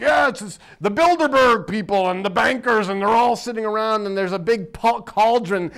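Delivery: loud, hammed-up conspiracy nut; mock conspiracy theorist. Mocking conspiracy bloggers.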